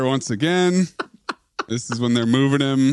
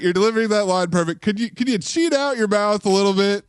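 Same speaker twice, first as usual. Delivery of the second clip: higher, quick playful director; 7 semitones higher than usual. Pretend. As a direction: coax him like a film director. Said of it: "Gerard, you're doing great".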